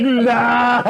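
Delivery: imitated drunk friend, high and loud; hammed-up drunk refusal; Ryan. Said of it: "Drunk Ryan goes".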